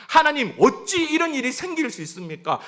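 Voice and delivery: high, loud outpouring to God; indignant lament. Acted. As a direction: pour out anger in protest. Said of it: "하소연하고 분을 쏟아놓습니다".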